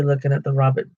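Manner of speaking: plain reading voice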